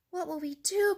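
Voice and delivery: high, mildly worried duck; cried out. A narrator's reading. Duck asking the hen, mildly alarmed cry. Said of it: "cried Ducky Lucky".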